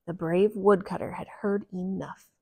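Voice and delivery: ordinary delivery, nothing marked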